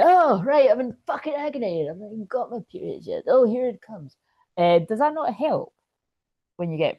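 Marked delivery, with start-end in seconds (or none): 0.0-4.2 mock exasperation. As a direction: act out the sudden agony with comic exasperation. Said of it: "it just kinda pops up and you".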